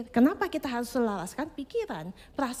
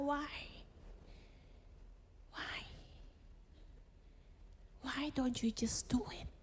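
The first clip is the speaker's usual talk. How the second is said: hushed, pleading why, fading almost to a whisper; soft father's plea; 3 semitones lower, 15 dB quieter, slower than usual. Retold or imitated. Father gently questioning his child after a mistake, hushed and sad. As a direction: let each why sink quieter than the last.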